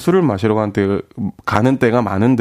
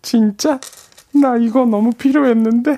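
put-on high delighted girl; girl's voice; 11 semitones higher, slower than usual. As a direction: gush like a thrilled girl. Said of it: "여자 목소리를 낼 때".

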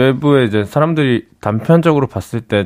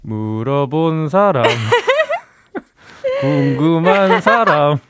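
slow, drawn-out playful retort; mock comeback; 6 semitones higher, slower than usual. Co-host answering the host's teasing, drawn-out mock retort. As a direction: stretch the words out, rising high, teasing.

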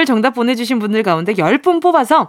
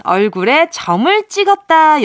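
ordinary delivery

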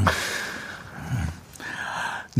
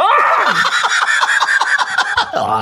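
high shrieking laugh; burst of laughter. Real, not acted. Host bursting out laughing after the skit.

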